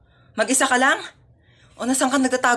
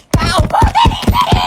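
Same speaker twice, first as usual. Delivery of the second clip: high, loud shout toward the house; shouted call; 7 dB louder than usual. Acted. Delivery girl yelling at a house nobody answers, high and loud. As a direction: bellow it at the door.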